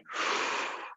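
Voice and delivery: soft unvoiced breath out; breathy exhale. Shown as a demonstration. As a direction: show the long out-breath. Demonstrating breath out.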